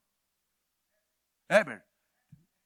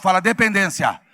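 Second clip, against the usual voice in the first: loud call to repeat; loud prompt; 6 semitones higher, 13 dB louder than usual. Shown as a demonstration. Preacher getting congregation to repeat, loud prompt. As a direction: project it to the whole crowd. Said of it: "Vamos falar bem alto?".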